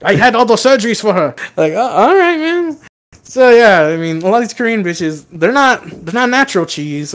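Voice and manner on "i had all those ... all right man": higher, pushed-up imitation; hammed-up retold exchange; mock supervisor voice. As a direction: play the husband's confession big. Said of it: "He's like".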